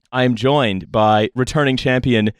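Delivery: ordinary talk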